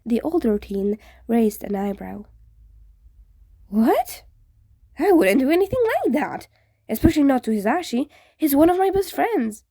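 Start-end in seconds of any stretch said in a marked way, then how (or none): 3.7-9.6 higher, louder protest; surprised, quick denial; indignant ghost. Acted. Ghost defending his friend, higher and louder.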